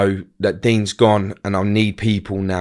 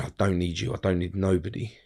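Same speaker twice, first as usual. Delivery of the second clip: cold and quiet; 4 semitones lower, 9 dB quieter than usual. Retold or imitated. Cold refusal to cousin.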